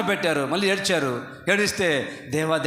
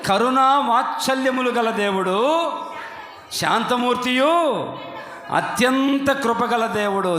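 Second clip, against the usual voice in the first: raised, slow, with a laugh in the voice; joyful proclamation; 6 semitones higher, 3 dB louder, slower than usual. Acted. Preacher repeating the verse to his church, laughing with joy. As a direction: beam through the words as you repeat them.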